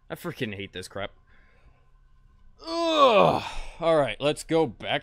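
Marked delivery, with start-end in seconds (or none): 2.7-3.4 loud exasperated ugh; frustrated groan. Real, not acted.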